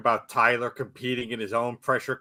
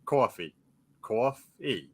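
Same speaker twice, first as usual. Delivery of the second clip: put-on practising coffee in New York accent; 4 dB quieter, slower than usual. New Yorker practising on the subway, New York accent. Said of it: "my New York accent to be so bad".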